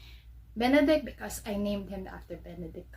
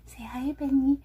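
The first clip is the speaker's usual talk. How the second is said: whispered coaxing; 4 semitones higher, 3 dB louder than usual. Real, not acted. Owner whispering to her dog to say hi.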